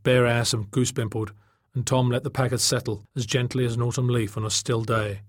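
ordinary delivery

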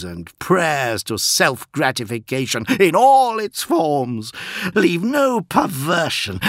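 high, slow, loud proclamation; grandiose prince. Acted. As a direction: proclaim it like a decadent god-king.

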